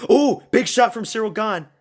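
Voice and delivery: loud, high, crying out at the big punch; excited outburst. Real, not acted. Streamer reacting live to a big punch, loud excited cry. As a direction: blurt it out as the shot lands.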